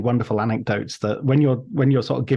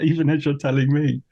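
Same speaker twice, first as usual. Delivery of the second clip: ordinary delivery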